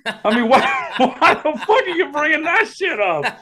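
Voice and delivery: high, laughing rant; laughing at the new boss's remark; laughing disbelief. Real, not acted. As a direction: laugh through the outrage.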